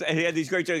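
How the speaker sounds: usual speaking voice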